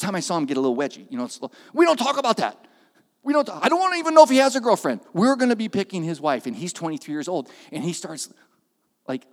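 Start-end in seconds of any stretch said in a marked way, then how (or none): 1.7-6.5 loud, higher, fast rush; flustered protest. Retold or imitated. Driver insists on choosing his son's wife, flustered. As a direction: blurt it out flustered.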